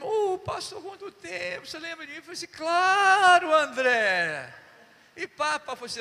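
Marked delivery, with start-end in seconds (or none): 0.0-2.2 much higher, eager greeting voice; excited old friend. Retold or imitated. André greeting the pastor after twenty years, high and eager. 2.5-4.5 loud, high "Claro"; enthusiastic shout. Retold or imitated. Pastor pretending to remember André, loud and cheery.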